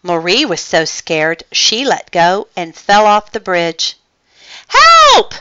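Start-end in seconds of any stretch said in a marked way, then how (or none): 4.7-5.4 high, loud frightened shout; cry for help. A narrator's reading. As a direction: shriek like a falling kitten. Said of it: "cried Marie".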